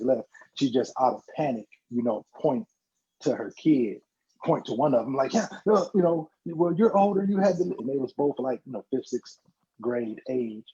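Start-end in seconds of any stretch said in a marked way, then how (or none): 5.3-7.7 higher-pitched, halting acted excuse; mock flustered justifying. Film mom justifies her pick to her kid, mock flustered. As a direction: fumble for a reason, pitched up.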